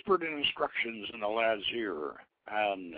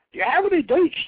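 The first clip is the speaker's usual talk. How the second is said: much higher, much louder hearty call; booming jolly king; 9 dB louder than usual. Acted. The holy king calling to the magistrate, hearty and loud. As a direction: hail the table like a big jovial giant.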